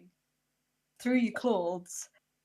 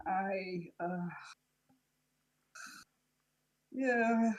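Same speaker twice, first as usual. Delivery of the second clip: hesitant, with a long pause; reluctant admission, a touch of regret; slight regret; 5 dB quieter, much slower than usual. Acted. Dennis admits he chose to be with him, slight regret. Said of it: "slight regret".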